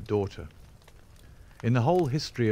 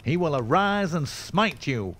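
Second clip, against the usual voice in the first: high, ringing prophetic threat; impassioned denunciation, pitched well up; crying out; 8 semitones higher, 4 dB louder than usual. A narrator's reading. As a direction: hurl divine vengeance at him. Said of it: "cried young Drebber".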